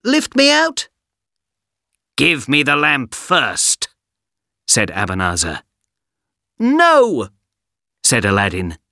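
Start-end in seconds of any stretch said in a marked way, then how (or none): 0.0-0.9 put-on high, strained boy's plea; pleading boy. Aladdin trapped in cave. 2.2-3.9 stern villain. Acted. Magician demands lamp. 6.6-7.3 high, firm little no; defiant boy. Acted. Aladdin refuses.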